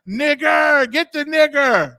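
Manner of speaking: loud, high mock holler; imitated hollering. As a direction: holler it out, imitating a mom at home. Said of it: "all you hear is mom hollering".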